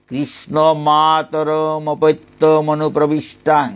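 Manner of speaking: measured, chanted Sanskrit shloka; solemn scriptural recitation; verse recitation. Acted. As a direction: intone the verse word by word like scripture.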